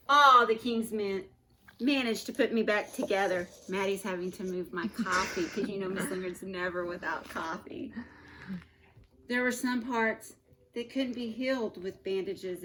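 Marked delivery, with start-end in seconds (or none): none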